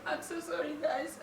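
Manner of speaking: real tears catching the apology; choked up. Real, not acted. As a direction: voice breaking with emotion. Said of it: "This is so emotional".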